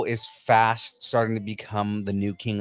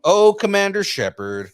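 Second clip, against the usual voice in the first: higher, louder, slow and grand; dramatic hero name; 10 semitones higher, 6 dB louder than usual. Pretend. Naming the game hero to cohosts, slow and grand. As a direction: savor the name like a game trailer. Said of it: "Can you say that again".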